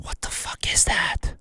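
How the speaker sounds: hushed whisper, leaning in close; whispered disbelief. Retold or imitated. Whispering to himself over the strange spot under his nail. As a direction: whisper it like you can't believe your eyes.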